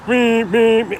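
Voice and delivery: loud, high honking "meh" noises; imitating a bike horn. Retelling the man blasting his bike horn, loud high honks. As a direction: honk it out like a blaring horn. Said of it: "starts going like".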